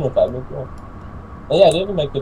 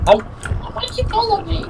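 panicked shriek; 3 dB louder, slower than usual. Real, not acted.

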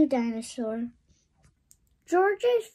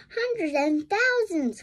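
cry out; 7 semitones higher, 5 dB louder than usual. Acted. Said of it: "cried Peppa".

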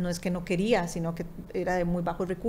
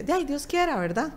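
humoring her; 6 semitones higher than usual. Retold or imitated. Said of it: "Así como, como que [...] siguiéndote ahí la corriente".

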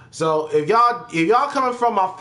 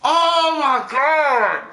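drawn-out groan; 11 semitones higher, 4 dB louder, much slower than usual. Real, not acted.

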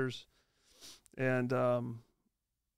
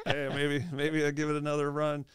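a touch of eager excitement, louder; fired up; 3 semitones higher, 5 dB louder than usual. Retold or imitated. Retired player tempted by a comeback call, mildly fired up. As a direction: act out getting mildly fired up. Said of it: "I got all fired up".

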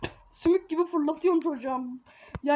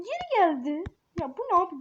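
normal talking voice

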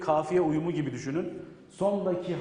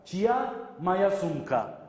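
slow model sentence; slower than usual. Shown as a demonstration. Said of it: "Burada da maya".

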